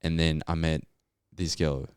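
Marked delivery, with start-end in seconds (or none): none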